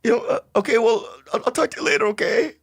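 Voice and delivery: high, flustered mock farewell; pretend shaken reaction. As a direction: fumble to end the call, high-pitched. Pretend reaction to death news call.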